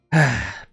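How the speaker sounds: audible sigh before going on; real sigh. Real, not acted. Streamer torn over dropping a game, real sigh. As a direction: sigh it out, then carry on. Weighing whether to drop the game.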